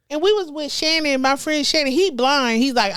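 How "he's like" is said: usual speaking voice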